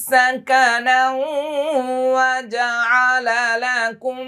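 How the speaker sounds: slow, high melodic tilawat; Quran recitation. Shown as a demonstration. Teacher chanting the verse for students, slow and high. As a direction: chant each word long and high.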